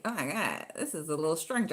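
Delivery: low murmured thought; inner thought. Retold or imitated. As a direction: think it to yourself under your breath. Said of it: "I'm thinking".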